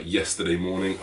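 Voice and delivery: usual speaking voice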